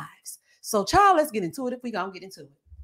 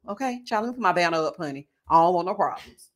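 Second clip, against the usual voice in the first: ordinary talk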